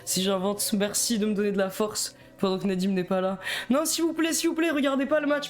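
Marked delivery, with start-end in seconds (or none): 3.7-5.5 high-pitched mock begging; playful pleading. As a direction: beg the viewers not to leave. Begs viewers not to leave. (supplication feinte, voix aiguë)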